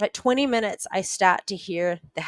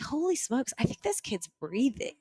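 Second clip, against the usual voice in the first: higher, hushed astonishment; stunned disbelief; 4 semitones higher, 7 dB quieter than usual. Retold or imitated. Rescuer realising the drowned boy is breathing, hushed amazement. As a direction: breathe it out, not daring to believe it.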